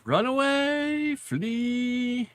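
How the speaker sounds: high, slow mock panic squeal. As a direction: squeal it out in fake terror. Mock alarm at the legalese.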